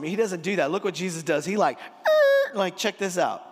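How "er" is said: very high, loud screeched "er"; mock screech to a halt. Preacher showing Jesus stopping the flattery, high comic screech. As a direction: cut the praise off with a squealed noise.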